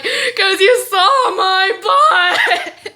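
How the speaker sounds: loud, high, very slow mock bawling; wailed excuse. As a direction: bawl every word out theatrically. Crying excuse from the bathroom.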